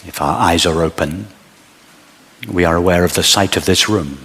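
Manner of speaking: usual speaking voice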